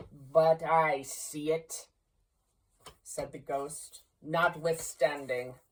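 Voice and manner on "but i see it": slow, hollow ghostly voice; ghost's reply. Acted. The ghost calmly refuting Scrooge, slow and hollow. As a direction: let it fall heavy and unmoved.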